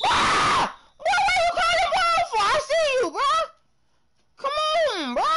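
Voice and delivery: high, loud screaming at a teammate; real scream. Real, not acted. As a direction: scream it out in full frustration. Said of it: "I can't even scream like that".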